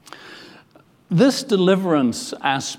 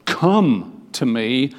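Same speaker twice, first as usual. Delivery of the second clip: slow, drawn-out commanding come; slow and weighty, every word pressed; forceful command; much slower than usual. Shown as a demonstration. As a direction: call them with authority and urgency. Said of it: "Jesus gives this very forceful".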